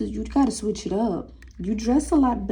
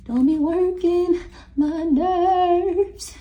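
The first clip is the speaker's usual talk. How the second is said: higher, slow, stretched-out whine; mock high whine; 9 semitones higher, 4 dB louder, much slower than usual. Shown as a demonstration. Reader repeating her warning in a high, slow mocking whine. Reader scolds spirits, then mocks.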